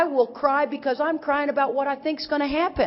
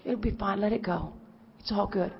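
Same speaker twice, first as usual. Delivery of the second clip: soft soothing quote; 3 semitones lower, 9 dB quieter than usual. Retold or imitated. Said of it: "they'll pat her on".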